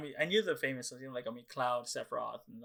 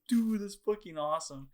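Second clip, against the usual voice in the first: hyped; 6 semitones higher, 5 dB louder than usual. Retold or imitated. Hyped at the final fight.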